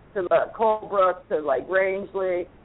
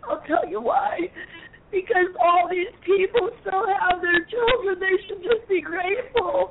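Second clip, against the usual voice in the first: crying; 10 semitones higher than usual. Real, not acted. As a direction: voice breaking into tears, much higher. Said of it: "it makes me wanna cry".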